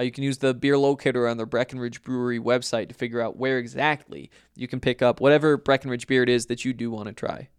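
ordinary talk, nothing marked

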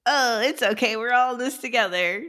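high, bright encouragement; upbeat cheer. Real, not acted. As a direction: cheer her on. Cheering up a friend.

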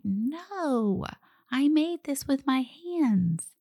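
put-on high, slow little-kid voice; little child. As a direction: play a small child proudly insisting. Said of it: "our little children will be like".

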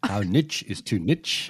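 both niche said as "nitch", short i; niche rhyming with "pitch", laugh breaking in; American pronunciation. Shown as a demonstration. Re-reading the question for American listeners, niche as "nitch". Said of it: "because they like saying niche".

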